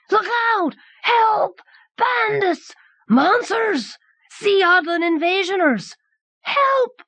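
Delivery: very high panicked shrieks; drunken shrieking. A narrator's reading. Drunk catching sight of the inhuman crowd, shrieking in high panic. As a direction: shriek each alarm high, slurring like a drunk. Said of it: "resumed his drunken shrieking".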